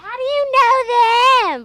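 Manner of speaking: put-on high, loud, slow old-person voice; old people voice. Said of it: "I was making the old people voice".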